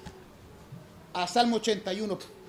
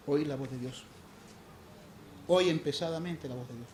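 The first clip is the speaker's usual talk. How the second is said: soft, low, intimate sermon voice; hushed, earnest preaching to the congregation; 9 semitones lower, 13 dB quieter than usual. Acted. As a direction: preach gently, almost confiding.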